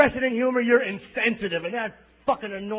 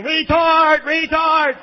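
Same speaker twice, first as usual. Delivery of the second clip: loud mock yell, higher; mock outburst yelling the slur; 6 semitones higher, 7 dB louder, much slower than usual. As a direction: shout it across the plane. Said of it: "standing up yelling".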